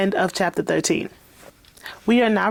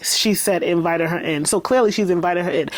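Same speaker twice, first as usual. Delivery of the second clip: ordinary delivery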